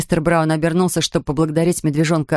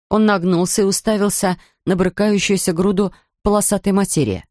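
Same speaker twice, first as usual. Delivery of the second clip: ordinary delivery, nothing marked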